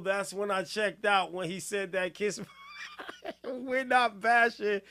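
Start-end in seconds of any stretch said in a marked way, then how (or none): 2.8-3.4 short high squeal of laughter. Real, not acted.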